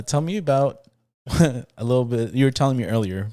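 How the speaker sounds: usual speaking voice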